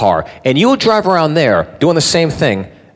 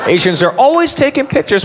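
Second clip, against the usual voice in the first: much higher mocking restatement; mock incredulous repeat; 8 semitones higher than usual.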